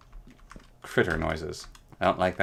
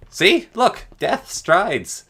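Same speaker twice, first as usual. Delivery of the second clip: high, loud hammed-up alarm; mock alarm; 11 semitones higher, 7 dB louder, much slower than usual. Streamer points viewers at a harmless mob, in loud mock terror. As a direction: yelp it out like doom is coming.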